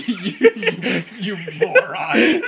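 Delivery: loud laughing, drawn out; laughing insult. Real, not acted. Mock insult while laughing.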